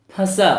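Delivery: फंसा with a nasal first vowel; nasalised vowel. Shown as a demonstration. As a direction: send the first vowel of फंसा through nose and mouth. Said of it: "नाक और मुंह दोनों की सहायता से".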